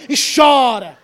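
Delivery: sudden loud shout. Real, not acted. Preacher shouts to the congregation, loud and sudden. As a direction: belt out the last word.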